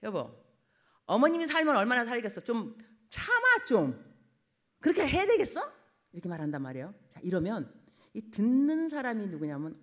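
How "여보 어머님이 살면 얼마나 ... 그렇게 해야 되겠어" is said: loud, chiding plea to put up with it; husband lecturing his wife to endure; scolding husband. Retold or imitated. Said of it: "남편이 어떻게 말을 하냐면".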